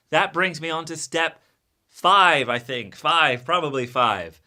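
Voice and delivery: loud, high, punchy motivational coaching; emphatic lecturing. Acted. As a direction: hammer each point home to the class. Builds up his absurd lesson triumphantly.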